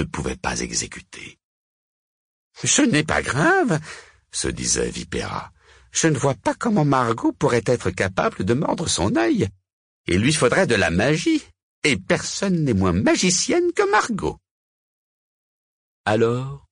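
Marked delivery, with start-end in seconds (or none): 2.6-3.8 high-pitched witch reassuring herself; wiedźma Vipera. A narrator's reading. 5.9-14.4 high-pitched witch reasoning to herself; Vipera. A narrator's reading.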